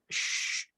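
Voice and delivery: soft unvoiced shh with pouted lips; hushing a child. Shown as a demonstration. Teacher mimics parent silencing child, soft shush. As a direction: shush quietly through rounded lips. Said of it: "suruh anak senyap".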